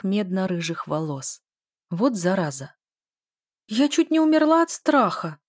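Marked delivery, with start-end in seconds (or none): 3.7-5.4 high, loud hurt cry; offended shout. A narrator's reading. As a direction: shout it out, stung and indignant. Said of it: "крикнула я обиженно".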